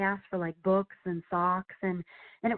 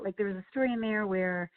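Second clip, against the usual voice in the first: usual speaking voice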